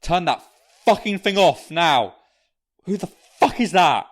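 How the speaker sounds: loud, raging yell; furious roar; furious shout. Acted. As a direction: bellow at them to shut it off. Father yelling to turn radio off.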